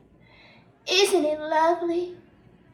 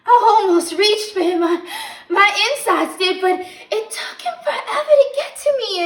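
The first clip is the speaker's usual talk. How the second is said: loud, high, bubbling over; breathless excitement; 6 semitones higher, 7 dB louder than usual. Acted. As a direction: gush with laughing impatience. Excited waiting for Rodney.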